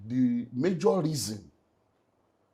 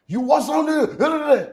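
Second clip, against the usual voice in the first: high, slow, drawn-out bystander voice; imitated bystander; 13 semitones higher, 11 dB louder, much slower than usual. Retelling the accident to the host, imitating a high, slow onlooker. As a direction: mimic the startled onlooker at the crash.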